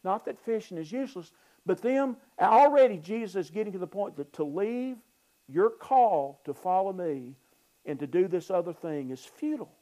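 ordinary talk, nothing marked

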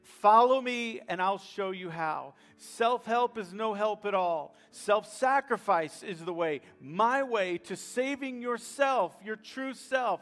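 loud, slow, emphatic preaching of quoted words; forceful exhortation. Retold or imitated. Jesus teaches the crowd about suffering, loud and urgent. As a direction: urge the crowd on, punching each phrase.

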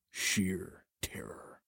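slow breathy whisper. Pretend. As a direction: hush it out slowly. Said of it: "dramatic whisper".

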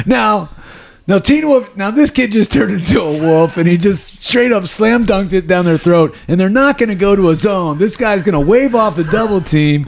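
sportscaster voice. Retold or imitated. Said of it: "and he's like".

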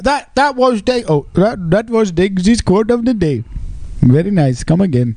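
hammed-up Indian shopkeeper accent; singsong fake Indian accent; mock Indian accent. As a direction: put on a cartoon Indian accent. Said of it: "Thank you, uh, Apu".

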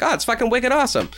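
high mock New Hampshire accent; Boston-like New Hampshire accent. New Hampshire native showing the only local phrase, Boston-like accent. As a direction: put on a wicked Boston-style twang. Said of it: "New Hampshire accent other than when we say".

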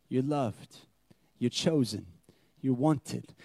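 quiet loving reassurance; gentle affirmation. Retold or imitated. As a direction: tell a child gently. Father affirming adopted kids.

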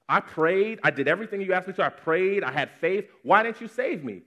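high, loud pleading protest; drowning man high-pitched, complaining to God; exasperated complaint. Acted. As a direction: demand answers from God, voice climbing.

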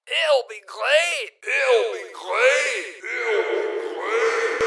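slow, very high, shouted mock Scottish; Scottish accent. Replayed clip of a host ruining a Scottish accent, shouting. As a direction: bellow it in a hammed-up Scottish accent. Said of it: "no further attempts at any kind of Scottish accent".

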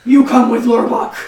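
loud, booming broken-English brute; dumb orc voice. Acted. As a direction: boom it out like a hurt dumb orc. Orc character yields.

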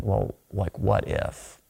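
very soft, half-voiced musing; hushed regret. Peter asks himself while watching his friend suffer, hushed regret. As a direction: let it sink almost to a whisper.

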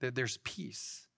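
ordinary delivery

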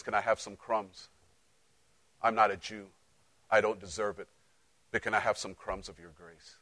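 hushed, humble plea for crumbs; quiet pleading. Acted. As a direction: beg softly, almost under the breath. Pleading woman quoted in sermon.

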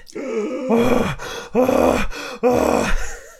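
loud, high, heaving sigh; big exasperated sigh out loud; heavy sigh. Real, not acted. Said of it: "you're humping the table".

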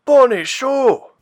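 high, loud, drawn-out cheer; big high-pitched exclamation; exclaimed. A narrator's reading. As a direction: call it out in delighted admiration. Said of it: "Bauer exclaimed".

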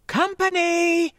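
high, slow shouted warning; calling out. Acted. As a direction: call "Company!" loud and high. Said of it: "she called".

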